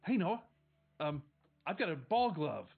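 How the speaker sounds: put-on bright eager little boy; a seven-year-old showing off his glove; eager kid. Little brother shows Noah his glove, eager. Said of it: "immediately walks up and says".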